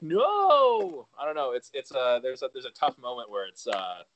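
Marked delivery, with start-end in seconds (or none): 0.0-1.1 a sudden high, loud cry of "No!"; anguished no. Retold or imitated. Recalling the film's falling out, high loud cry of no. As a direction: cry out "No!" as the couple split. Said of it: "and it's like".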